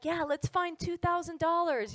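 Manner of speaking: super casual. Retold or imitated. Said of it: "I'm super casual about it".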